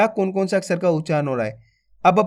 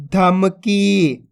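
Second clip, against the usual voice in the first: slow blended word; much slower than usual. Shown as a demonstration. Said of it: "तो मिलके बन जाएगा".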